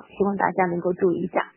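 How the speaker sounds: usual speaking voice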